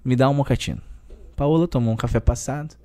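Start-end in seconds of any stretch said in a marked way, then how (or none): none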